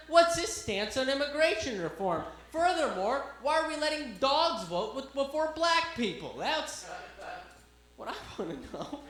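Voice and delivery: high, loud, building outrage; mock indignant questioning. Comic questions the police dog's credentials, loud high mock outrage. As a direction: press the point in comic indignation.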